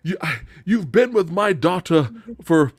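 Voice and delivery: outraged British father, pitched high; over-the-top British. Retold or imitated. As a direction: ham up the British indignation. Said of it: "But he's all like".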